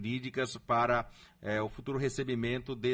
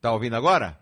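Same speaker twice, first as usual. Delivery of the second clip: loud, for a listener who can't hear; to someone hard of hearing; 7 dB louder, faster than usual. Real, not acted. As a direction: project it over a bad line. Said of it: "Não, não tô ouvindo não".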